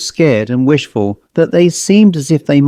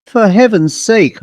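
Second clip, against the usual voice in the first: higher, sharp alarmed exclamation; startled cry; 7 semitones higher than usual. Acted. Woman startled by a ghostly child, alarmed high cry. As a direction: jump at the sight, voice up high.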